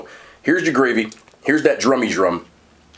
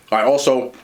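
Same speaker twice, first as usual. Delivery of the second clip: ordinary delivery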